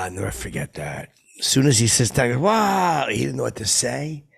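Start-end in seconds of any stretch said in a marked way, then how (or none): 2.4-3.1 high, loud, falling cry; mock indignant outburst. Man reacting as he sits down, loud mock indignation. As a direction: imitate an affronted man exploding. Imitating the same affronted reaction.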